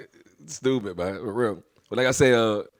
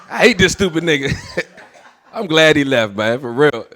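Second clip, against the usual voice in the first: usual speaking voice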